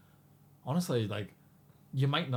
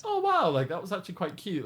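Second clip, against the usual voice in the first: mock wowed; 8 semitones higher, 4 dB louder than usual. Retelling reaction after the event.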